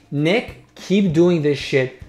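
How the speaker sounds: ordinary talk, nothing marked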